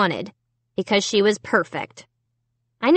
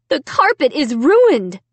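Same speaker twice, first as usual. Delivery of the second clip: loud, slow, raised-pitch dismay; exclaiming; 6 semitones higher, 5 dB louder than usual. A narrator's reading. Mom stepping into the paint-splattered room, exclaiming. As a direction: cry out over the damage, dragging the words. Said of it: "Mom exclaimed".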